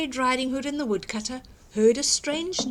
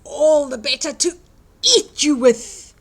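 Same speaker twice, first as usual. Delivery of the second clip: menacing wolf roar; 4 semitones higher, 10 dB louder than usual. Acted. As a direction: snarl it before the pounce. Wolf's climactic threat.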